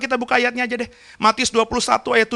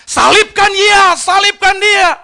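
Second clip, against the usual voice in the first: loud, slow, high crowd yell; crowd shouting; 10 semitones higher, 7 dB louder, slower than usual. Retold or imitated. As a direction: shout it like the angry mob. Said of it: "orang banyak teriak". (teriakan massa yang lantang)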